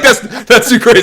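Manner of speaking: high laughing squeal of disbelief; laughing mock overwhelmed. Friend quoted after a scary flight, laughing high. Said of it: "It's like".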